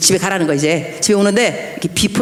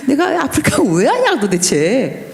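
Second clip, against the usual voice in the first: laughing lament; 5 semitones higher, slower than usual. Retold or imitated. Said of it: "막 신세 한탄".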